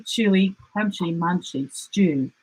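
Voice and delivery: ordinary delivery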